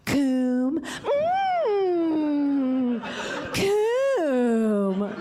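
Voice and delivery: breathy perfume-commercial purr; sultry ad voice. Retold or imitated. Fancy ad announcer sells the product, in a sultry purr. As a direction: seduce like a luxury ad. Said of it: "call it something fancy".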